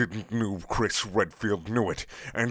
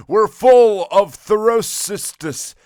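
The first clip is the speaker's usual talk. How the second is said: much louder, higher, slow hammed villain cry; booming villain; 11 semitones higher, 11 dB louder, slower than usual. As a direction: bellow it out like a cartoon baddie. Villain line in next room.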